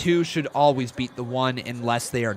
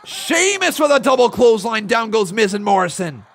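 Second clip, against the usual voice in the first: loud, high commentator calling the count; excited play-by-play; 7 semitones higher, 7 dB louder than usual. Acted. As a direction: call the action like a wrestling announcer. Calls the match like an announcer.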